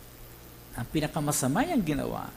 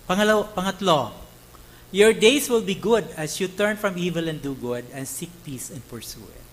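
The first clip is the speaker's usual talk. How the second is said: ordinary delivery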